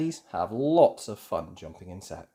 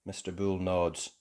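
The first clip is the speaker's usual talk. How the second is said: ordinary delivery, nothing marked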